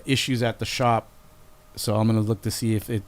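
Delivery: ordinary talk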